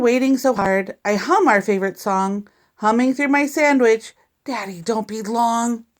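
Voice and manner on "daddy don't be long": plain reading voice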